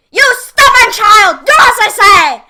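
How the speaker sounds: high, loud, furious witch voice; impatient demand. A narrator's reading. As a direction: snap the order at the stubborn child. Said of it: "the witch demanded impatiently".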